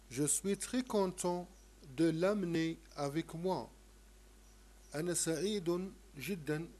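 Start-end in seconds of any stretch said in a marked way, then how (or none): 0.1-3.7 slow, clearly spaced French for learners; slow model reading. Shown as a demonstration. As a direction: say it slowly so pupils can repeat. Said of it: "قل هذا الحوار مع أصدقائك". (قراءة نموذجية بطيئة)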